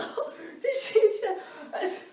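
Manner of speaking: very high, slow, laughing whimper; mock wounded whimper. Her feelings hurt by the coach, a laughing mock whimper. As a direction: play the hurt little girl for laughs.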